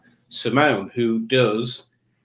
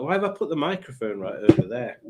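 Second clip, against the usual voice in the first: ordinary talk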